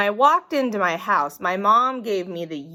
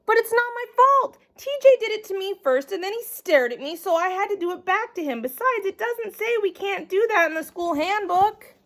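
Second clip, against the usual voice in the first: put-on high, fast, pleading kid voice; whiny child excuses; 9 semitones higher than usual. A narrator's reading. Noodle arguing with his teacher, high and rushed. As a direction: rattle off excuses like a cornered kid.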